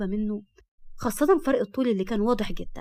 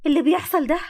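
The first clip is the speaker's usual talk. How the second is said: louder, higher indignant question; sharp shout; 6 semitones higher, 6 dB louder than usual. A narrator's reading. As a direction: burst out in sharp alarm. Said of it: "فصرخت بحدة".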